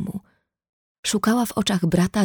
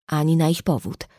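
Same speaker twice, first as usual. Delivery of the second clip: usual speaking voice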